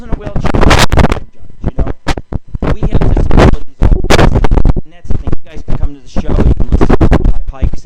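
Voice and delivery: shouting at the listeners, pushing hard; loud ranting. Real, not acted. Radio host preaching acceptance to his listeners, shouting. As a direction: rant it out full force.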